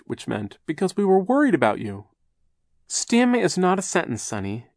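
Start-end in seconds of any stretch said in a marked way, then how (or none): 0.7-2.1 put-on earnest child. A narrator's reading. Translating Sonny's meaning. 2.9-4.7 high, prim scolding aunt; stern aunt. A narrator's reading. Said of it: "said sternly".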